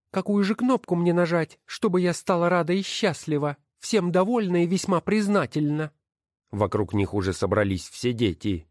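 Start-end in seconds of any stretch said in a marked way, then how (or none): 0.1-5.9 high, scornful woman's voice; wife's voice. Acted. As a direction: sneer at each title as you sort them. Wife mocks the machine. (высокий насмешливый голос жены)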